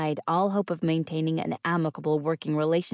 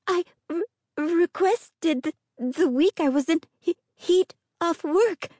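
frightened stammer; 14 semitones higher, 4 dB louder than usual. A narrator's reading. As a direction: stumble over words in fear. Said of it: "Her stammers grew worse".

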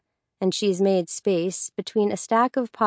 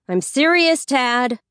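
higher, louder insistence; exasperated warning; 6 semitones higher, 5 dB louder than usual. A narrator's reading. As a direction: warn a lazy sibling, voice rising. Exasperated sister warns sleeping brother.

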